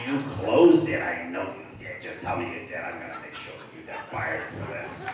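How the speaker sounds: impersonated phone bully, loud start then low threat; low, intimidating mock boss voice; menacing caller. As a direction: press hard, then drop low to threaten. Imitates Weinstein's threat on the phone.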